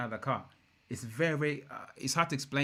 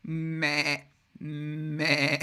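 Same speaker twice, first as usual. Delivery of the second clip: sheep bleats; 3 semitones higher than usual. Retold or imitated. Mocking the car's exhaust note, sheep bleats. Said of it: "like a legit sheep".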